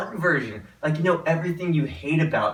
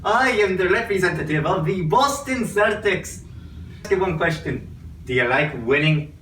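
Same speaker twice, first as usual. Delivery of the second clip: hammed-up Irish brogue, Celtics rep pitch; a stage Irishman selling the Celtics; bad Irish accent. Celtics rep pitches a fan in a bad Irish accent. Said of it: "the worst Irish accent I've ever heard".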